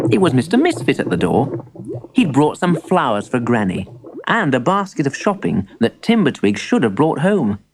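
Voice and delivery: usual speaking voice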